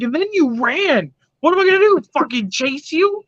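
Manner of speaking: high-pitched, mock-incredulous retort; incredulous comeback. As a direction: retell it as exasperated disbelief. Retold incredulous comeback to the nurse.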